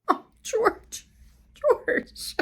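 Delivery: high, breathy mock lament; mock wailing. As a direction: wail his name in fake grief. Reader mourning lost sheep.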